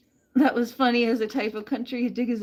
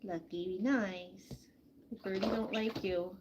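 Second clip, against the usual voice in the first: normal talking voice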